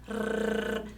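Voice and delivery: soft, sustained tongue trill "Rr"; trembling R. Shown as a demonstration. Teacher letting pupils hear how it trembles, soft trill. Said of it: "Agora é sua vez".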